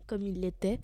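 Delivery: usual speaking voice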